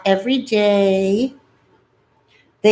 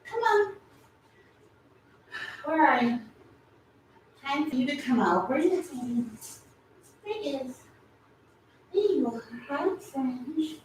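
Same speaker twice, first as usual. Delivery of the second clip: high, soft coaxing voice; 7 semitones higher, 10 dB quieter than usual. Real, not acted. Coaxing pet out softly.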